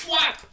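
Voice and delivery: a sudden high, loud "whap!" like a slap; smack sound effect. Retold or imitated. To viewers about mom's smack, a sharp high slap noise.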